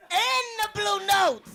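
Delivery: slow, drawn-out crooner shout; deep soul singer. Pretend. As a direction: belt it like an old soul group singer. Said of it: "She sound like [name] and the Blue Notes".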